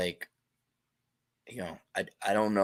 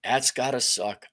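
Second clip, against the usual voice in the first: usual speaking voice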